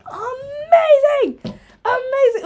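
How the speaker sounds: very high, loud, drawn-out cheer; excited squeal. Real, not acted. Streamer cheers a viewer who passed an exam, squealing. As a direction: squeal with delight for them.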